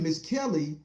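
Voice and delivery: usual speaking voice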